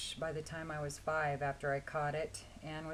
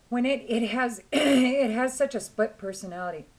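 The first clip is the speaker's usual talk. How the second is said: hoarse, throat-clearing voice; rough hoarse voice, clearing throat; 6 semitones higher, 11 dB louder than usual. Real, not acted. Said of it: "such a hoarse voice today".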